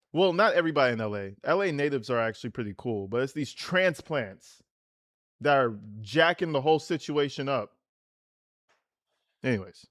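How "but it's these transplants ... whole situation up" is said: usual speaking voice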